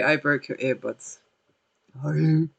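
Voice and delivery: usual speaking voice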